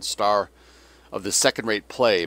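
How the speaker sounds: usual speaking voice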